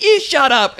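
high-pitched British heckler retort; mock British accent. British guy answering a heckler, mock British shout. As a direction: imitate a British heckler yelling back. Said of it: "this guy had a British accent".

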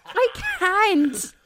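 exasperated. Real, not acted. Snapping back at teasing.

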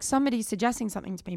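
ordinary talk

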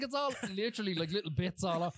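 ordinary delivery

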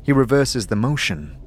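ordinary talk, nothing marked

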